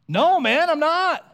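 high, loud emphatic denial; loud retort. Retold or imitated. Peter retorting to a bystander, high and loud. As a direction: fling back a sharp no, pitched high. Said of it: "Peter retorted".

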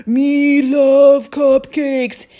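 put-on a boy hams up Cookie Monster for a cupcake. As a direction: stretch every word like a greedy monster. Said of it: "That's Cookie Monster who talks like that".

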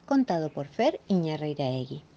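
usual speaking voice

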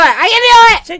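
high, loud and slow pep shout; self-psyching shout. Real, not acted. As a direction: pump yourself up, slow and loud. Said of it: "psyching herself up".

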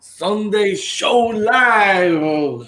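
slow, drawn-out announcer opening; show host intro. Acted. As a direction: welcome listeners like a radio host. (presentador de programa)

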